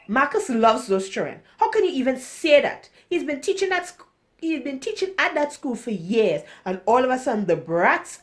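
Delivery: acted outrage, pushed up in volume; indignant retort. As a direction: fire back in disbelief on someone's behalf. Indignant defence of Marcus in dialogue.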